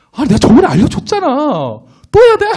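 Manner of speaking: put-on high-pitched, exasperated teen whine at a parent; irritated outburst. Grown child to parent asking for phone help, acted irritation. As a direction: act out snapping back, dragging out the last words. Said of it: "화를 내고 이렇게 승질을 내죠".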